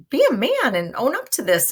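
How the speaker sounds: mock scolding. Paraphrasing Nurse scolding Romeo.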